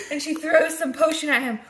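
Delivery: usual speaking voice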